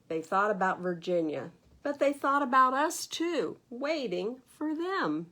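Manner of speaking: put-on expressive read-aloud to children; lively storybook narration for little listeners; story reading. A narrator's reading. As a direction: read it like a bedtime picture book. Reads picture book aloud.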